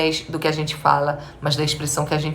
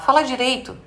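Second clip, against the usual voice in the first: higher-pitched mock reprimand; scolding parent; 7 semitones higher than usual. Parent to own child who speaks jumbled, mock scolding. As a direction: imitate a parent's correction. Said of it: "ou mesmo já fizeram".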